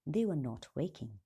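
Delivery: ordinary delivery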